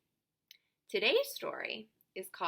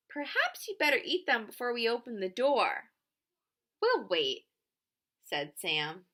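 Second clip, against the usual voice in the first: lively picture-book reading with voiced characters; reading to children. A narrator's reading. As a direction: read it out warmly, playing each child.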